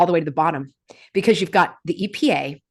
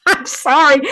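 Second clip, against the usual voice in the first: high, loud, laughing apology; laughter breaking into the words; 7 semitones higher, 5 dB louder than usual. Real, not acted. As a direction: apologise through the giggles.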